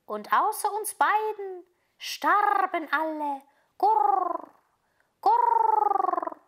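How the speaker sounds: high, slow, drawn-out pigeon voice with rolled gurr; cooing pigeons. A narrator's reading. Pigeons telling Gerda they saw little Kai, cooing. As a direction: coo the words like a pigeon.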